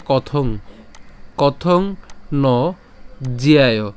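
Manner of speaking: কথং ন জ্যায় said word by word, very slowly; broken into syllables. Shown as a demonstration. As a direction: split জ্যায় off slowly and clearly. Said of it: "একটু ভেঙে বলি". (ভেঙে ভেঙে ধীরে উচ্চারণ)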